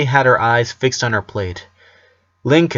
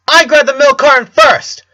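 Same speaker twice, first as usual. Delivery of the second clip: put-on shrill kid insisting, much louder; angry twin shouting; high loud child shout; 8 dB louder than usual. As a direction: yell it like a bratty child. Twins shout in unison.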